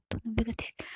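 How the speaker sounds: plain reading voice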